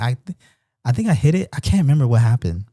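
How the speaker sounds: normal talking voice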